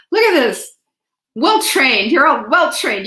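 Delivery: proud teacher whose class got it right; delighted praise. Real, not acted.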